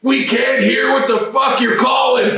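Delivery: high, loud yelled reprimand; angry shout. Retold or imitated. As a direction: bark it over the crowd noise. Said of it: "he goes".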